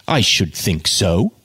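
slow, haughty reply. A narrator's reading. Jude answering Uncle Joe, haughtily and slow. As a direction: say it down the nose, slowly. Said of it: "said Jude haughtily".

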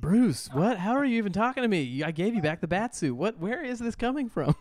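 high, swooping incredulous protest; hammed-up flustered outrage; acted indignant disbelief. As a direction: sputter baffled questions in a hurry. Plays Terry protesting to Bruce.

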